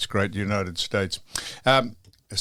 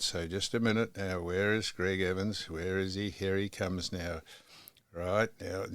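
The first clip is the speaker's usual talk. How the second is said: quiet waiting mutter; 3 semitones lower, 12 dB quieter than usual. Real, not acted. Waiting for phone to connect.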